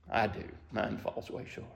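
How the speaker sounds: low, almost whispered admission; hushed confession. Real, not acted. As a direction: confess it softly, under the breath. Humble confession to congregation.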